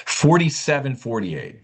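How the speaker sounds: usual speaking voice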